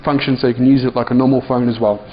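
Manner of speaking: ordinary delivery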